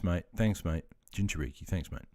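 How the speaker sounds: ordinary talk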